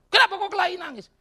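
loud, high imitation of a scolding father; mock scolding. Father demanding why his son cried, mock angry scolding. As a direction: demand an answer like a cross dad.

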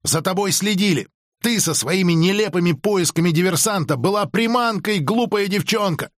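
резко, высоким голосом; отчитай повышенным тоном, не громче обычного; резкий упрёк. A narrator's reading. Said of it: "резко ответил Криди". (резко)

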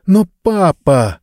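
put-on girl's high, slow protest to her father; object, dragging out the plea. A narrator's reading. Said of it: "возразила девочка". (возражая отцу, протяжно)

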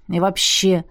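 mild mumbling grumble; grumbled. A narrator's reading. Moran starts a complaint, grumbling mildly. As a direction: begin with a touch of grumpiness. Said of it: "пробурчал Моран".

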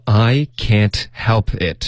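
"I can't help it" said slowly and deliberately; slow demo line. Shown as a demonstration. Teacher models the line slowly for students. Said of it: "这个也是生活中的常用词语".

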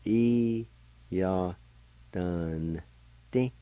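E, ya, dun held apart, ti short; syllable by syllable. Shown as a demonstration. Teacher showing the second part of "eager", syllable by syllable. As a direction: step through E, ya, dun, ti with pauses between. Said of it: "with the D V like this".

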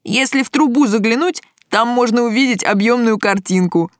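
lightly voiced enthusiastic guy; a touch of boyish eagerness in a character voice; eager lad. A narrator's reading. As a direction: show off what the toy does. Same lad explains the toy.

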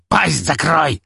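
loud high bark. A narrator's reading. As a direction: snap at him to shut up. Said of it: "рявкнул на подчинённого".

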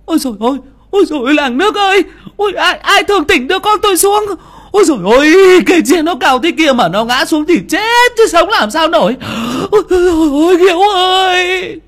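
high, loud keening wail; wailing lament. Acted. Mother wails to the village for her hanging son. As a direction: wail for your son, on your knees. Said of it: "quỳ sụp xuống mà gào khóc".

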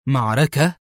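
surprised echo, rising sharply at the end; startled one-word question; astonished. A narrator's reading. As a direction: repeat it in disbelief. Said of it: "هتف في دهشة".

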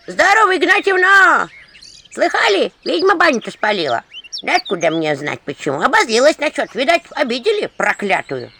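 put-on high, loud old gossip greeting; loud high old-woman voice; old villager. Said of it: "старческий голос".

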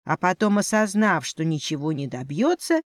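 ordinary delivery, nothing marked

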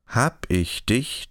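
slow, odd teasing menace; forced joking threat. A narrator's reading. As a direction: threaten him but force a joke. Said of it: "drohte Frau Behold gezwungen scherzend". (langsam, gezwungen scherzend drohend)

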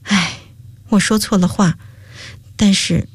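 regretful sigh, mildly voiced. A narrator's reading. Girl takes back her words to a friend, sighing. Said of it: "叹了口气道".

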